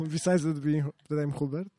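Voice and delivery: normal talking voice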